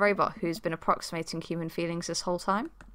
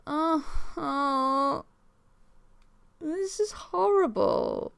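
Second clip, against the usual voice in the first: high, slow mock sobbing; hammed-up crying; 9 semitones higher, much slower than usual. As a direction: wail it out in exaggerated tears. Dad moved by daughter's sadness.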